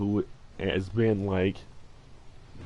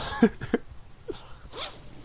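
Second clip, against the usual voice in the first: emotional laugh; 3 dB quieter than usual. Real, not acted. Laughs after admitting emotion.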